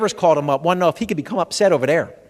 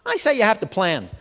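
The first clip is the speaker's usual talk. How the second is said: normal talking voice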